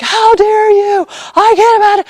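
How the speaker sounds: hammed-up fury of a kid who's ignored; imitating a furious girl. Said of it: "Gwen exploded".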